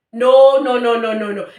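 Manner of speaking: loud burst of retold objection, tapering off; alarmed protest. Passenger objecting to the driver's strange route, loud retold protest. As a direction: re-enact a loud string of refusals.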